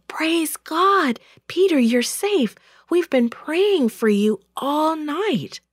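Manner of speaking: high-pitched, joyful relief; happy, high-voiced rush of greeting; excited welcome. A narrator's reading. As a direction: exclaim in delight that he is safe. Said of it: "Everyone began talking at once".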